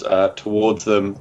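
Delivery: normal talking voice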